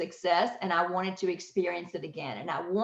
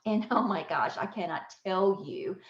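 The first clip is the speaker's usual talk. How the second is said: ordinary delivery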